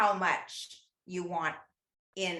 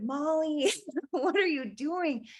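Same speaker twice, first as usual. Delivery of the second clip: mock shout from sister; 9 semitones higher, slower than usual. Sister calling through the wall to Molly, high mock shout. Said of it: "she shouted".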